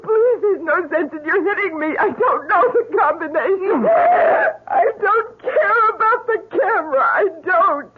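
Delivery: crying out and begging through sobs; terrified sobbing plea. Acted. Lisa begging the man hitting her, sobbing in terror. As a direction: plead in terror, voice breaking. Lisa beaten, pleading with attacker.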